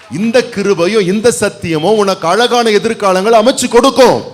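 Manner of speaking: high, loud pulpit preaching; full-force Tamil sermon voice; fiery preaching. Acted. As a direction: preach it out to the whole church. Preaching crescendo.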